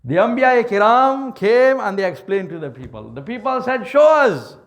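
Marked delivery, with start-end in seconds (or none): 3.9-4.6 louder, higher demand; demanding shout. Acted. People challenging the prophets, a loud high demand. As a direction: fling the challenge out.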